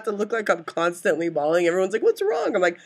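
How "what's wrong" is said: high-pitched imitation of concerned onlookers; mock concern. Everyone asking the teary host what's up, mock concern. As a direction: ask it way up high, imitating fussing people. Mimics everyone's worried question.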